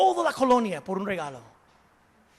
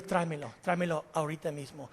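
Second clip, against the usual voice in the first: stern king; 7 semitones lower, 11 dB quieter, faster than usual. Acted. Angry king orders arrest.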